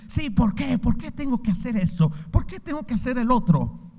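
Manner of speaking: whiny complaint. Retold or imitated. Mimicking a resentful listener's complaint.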